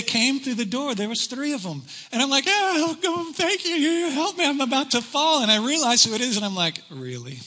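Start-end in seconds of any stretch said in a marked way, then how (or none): 2.5-5.4 high, pleading and grateful; panicked thanks while hanging on; desperate plea. Retold or imitated. 6.9-7.4 low, soft disbelief; quiet groan of disbelief; deadpan dismay. Retold or imitated. Dismayed at who the rescuers are.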